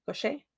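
Koshé tested quietly, rising at the end; unsure pronunciation. Shown as a demonstration. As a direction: try Koshé with "shay" lifting. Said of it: "We're gonna say Koshé".

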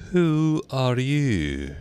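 slow, sleepy, drawn-out caterpillar voice; languid sleepy caterpillar. A narrator's reading. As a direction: languid and sleepy, stretching every word. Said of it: "in a languid, sleepy voice".

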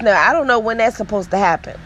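ordinary delivery

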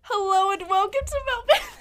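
high, loud podcast host voice; podcast on loudspeaker. Pretend. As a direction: play the podcast over a speaker. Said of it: "He's put it on loudspeaker".

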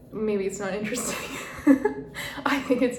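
quick self-mocking line into a breathy laugh; laughing at herself. Real, not acted. Telling listeners her story, she laughs at herself. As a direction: laugh it off lightly.

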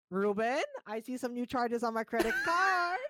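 a hint of a stern mother; mom voice. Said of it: "people hear my mom's voice".

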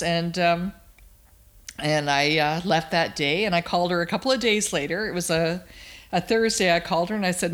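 usual speaking voice

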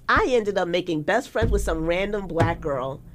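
ordinary talk

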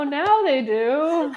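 slow, laughing through the words; laughing echo. Real, not acted. Echoing cohost's joke about students, laughing slowly. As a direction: drag it out while cracking up.

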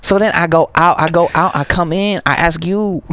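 thick mock Chinese accent, pitched high. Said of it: "Chinese accent".

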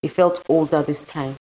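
usual speaking voice

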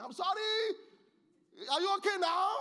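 imitated high, loud, angry yell; shouted apology. Wife imitating her husband's apology to the pastor, shouted. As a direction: hurl the apology as a mock shout. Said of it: "He just shouted at me".

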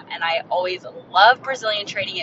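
ordinary talk, nothing marked

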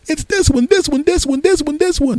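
very high, mocking repeated insistence; forceful insisting. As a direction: hammer every THIS in a high exasperated voice. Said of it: "forcefully was the buffalo chicken sandwich".

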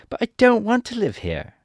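put-on high little-girl voice, protesting; protesting Dorothy. Dorothy refuses to stay in Emerald City, high protest. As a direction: cry out in a high girlish voice. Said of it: "cried Dorothy".

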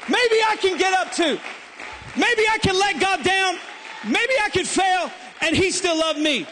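loud, high, shouted sermon climax; preaching at full force. Acted. Pastor shouting hope to a congregation, at full force. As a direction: preach it hard, building to a shout.

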